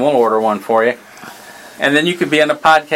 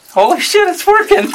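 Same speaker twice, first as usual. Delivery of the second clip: high, laughing amazement; shocked delight, laughing it out; amazed. Real, not acted. The device actually works.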